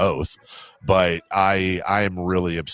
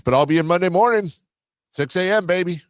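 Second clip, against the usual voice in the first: radio announcer; 6 semitones higher than usual. Acted. Radio show sign-off plug.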